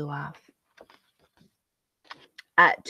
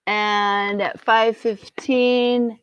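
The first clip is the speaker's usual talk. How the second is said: plain reading voice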